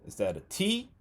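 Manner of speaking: T asked high and rising; mock questioning option. Shown as a demonstration. Teacher quizzing students with a silly wrong option, rising. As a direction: tease the class with the wrong choice T. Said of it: "Number three".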